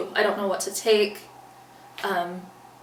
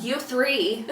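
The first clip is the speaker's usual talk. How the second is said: higher, exasperated scolding of the family; mock-scold them, half laughing; mock mad scolding; 5 semitones higher, 4 dB louder than usual. Daughter scolding family for not answering, mock mad. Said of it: "And I'm getting mad".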